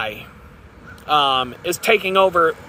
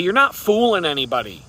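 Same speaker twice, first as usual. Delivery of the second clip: ordinary talk, nothing marked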